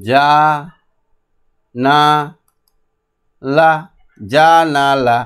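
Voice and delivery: "যা না লা" as long, separate syllables; sounding out. Shown as a demonstration. Teacher gives only the outward sound, "যা না লা" syllable by syllable. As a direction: pause between the parts of "যা না লা". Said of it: "বাইরে গিয়ে কিন্তু".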